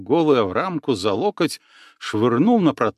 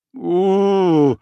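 loud, high, strained howl of pain; guttural howl; 6 semitones higher, 4 dB louder than usual. Acted. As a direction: howl from the gut, wounded. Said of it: "утробно завыл палач".

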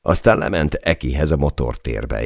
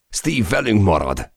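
shouting; 6 semitones higher than usual. Acted. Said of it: "kiáltottam a fülébe".

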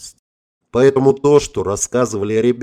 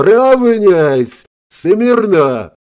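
high, loud barked parade commands; shouting drill sergeant; 8 semitones higher, 7 dB louder, much slower than usual. A narrator's reading. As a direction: bark orders like a sergeant. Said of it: "грозно зарычал".

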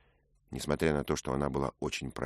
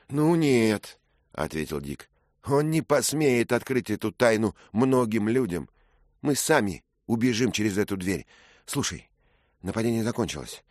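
louder, confident youth; steady her with confidence; young squire calming his love; young Dick reassuring; 6 semitones higher, 5 dB louder than usual. Acted.

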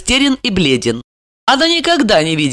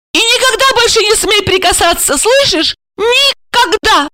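hysterical cry. A narrator's reading. Wife forbidding husband to touch her, hysterical cry. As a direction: warn in high hysterics, stretch the final word. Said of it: "истерично закричала она".

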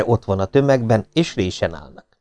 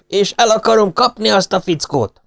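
shouting; 11 semitones higher than usual. A narrator's reading. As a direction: announce your rank over the mob. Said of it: "kiáltotta Drive".

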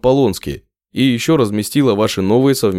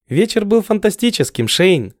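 higher, warmly thankful; grateful; 8 semitones higher than usual. A narrator's reading. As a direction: say it full of gratitude. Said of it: "с признательностью говорю я". (с благодарностью)

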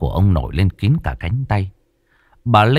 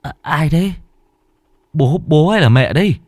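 higher, stuttering frightened question; trembling voice; 8 semitones higher than usual. A narrator's reading. Boy trembling in his dark room, high and frightened. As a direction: ask nervously, half hoping it's a parent. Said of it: "Phùng lại run run".

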